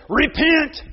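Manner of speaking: put-on a small boy repeating it proudly; child preacher echo. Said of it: "you hear Luke say".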